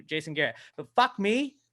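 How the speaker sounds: ordinary talk, nothing marked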